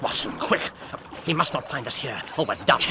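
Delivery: ordinary delivery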